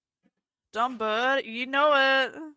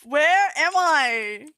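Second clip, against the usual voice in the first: slow, loud, higher exasperated outburst; getting angry; 4 semitones higher, 6 dB louder, slower than usual. Real, not acted. Flustered streamer losing their place while reading chat, exasperated. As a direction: stretch it out in rising frustration. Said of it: "I'm getting angry".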